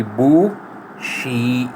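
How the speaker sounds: बू शी split apart, rising; syllable by syllable. Shown as a demonstration. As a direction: say बू then शी slowly, lifting the pitch. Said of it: "ये second tone है, बढ़ेगी".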